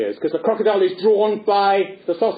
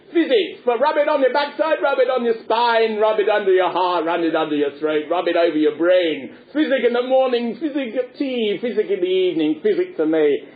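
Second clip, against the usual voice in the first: quack doctor's patter; 3 semitones higher than usual. Acted. Doctor administers physic to Punch, hammed-up rhythmic patter. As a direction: reel off a remedy rhythmically.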